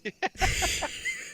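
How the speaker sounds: real high, wheezy giggle; high-pitched laugh. Real, not acted. Gamer reacting to the untested capsule, high laughter. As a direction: break into a helpless high laugh.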